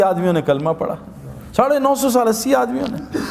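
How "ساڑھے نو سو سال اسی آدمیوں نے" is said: high, laughing repeat of the figure; laughing disbelief. Real, not acted. Preacher marvelling to his listeners, laughing. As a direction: laugh it out in wonder. Marvels at Noah's few converts.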